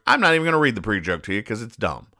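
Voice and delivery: normal talking voice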